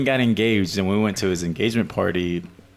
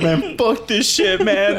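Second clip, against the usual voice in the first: high, slow disgusted grumble; hammed-up annoyed friend; fed up; 9 semitones higher, slower than usual. Ruben fed up, quoting.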